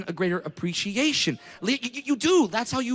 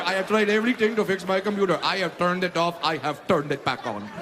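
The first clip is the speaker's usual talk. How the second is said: mock Indian accent. Friend pleading for tech help.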